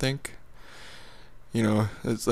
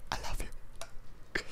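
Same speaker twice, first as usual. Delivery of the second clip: whispering; 16 dB quieter, much slower than usual. Pretend. Whispered sign-off.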